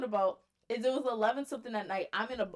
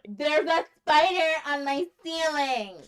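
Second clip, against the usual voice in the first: mock whiny friend; 4 semitones higher, 5 dB louder, slower than usual. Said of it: "tell it right".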